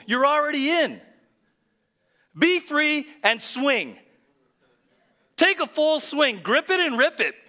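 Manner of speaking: high, loud preaching at full force. Acted. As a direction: belt it out to fire up the congregation.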